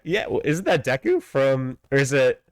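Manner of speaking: ordinary talk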